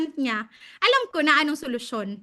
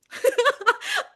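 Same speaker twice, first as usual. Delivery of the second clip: high embarrassed laugh; 9 semitones higher than usual. Real, not acted. Embarrassed at falling asleep.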